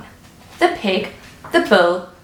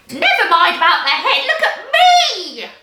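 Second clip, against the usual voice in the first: Grandma shouting, laughing; 9 semitones higher, 4 dB louder than usual. A narrator's reading. Grandma laughing and shouting from the roof for attention. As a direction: cackle and demand to be noticed. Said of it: "Grandma shouted from the rooftop".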